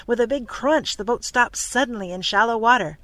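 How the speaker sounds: ordinary delivery, nothing marked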